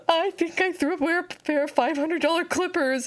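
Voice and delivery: high panicked confession. Retold or imitated. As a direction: blurt it out high and worried. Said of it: "So I'm running out there like".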